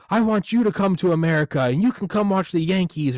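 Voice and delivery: high, loud glad-handing politician; hammed-up campaigner inviting strangers over; LBJ. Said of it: "L B J was huge".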